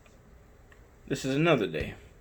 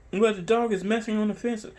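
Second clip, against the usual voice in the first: higher mocking quote with a laugh; 8 semitones higher than usual. Owner mocking the neighbor's fence complaint, laughing. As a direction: laugh at the neighbor's claim while quoting her. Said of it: "She's like".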